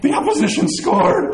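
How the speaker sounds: high-pitched, hammed-up alarm; mock panic. Fan seeing the opponent score, mock panic. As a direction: exclaim as if all is lost.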